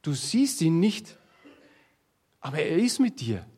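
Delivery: ordinary talk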